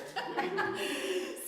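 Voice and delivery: high unvoiced burst of laughter; amused laugh. Real, not acted. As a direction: laugh at the irony. Laughing at her own point.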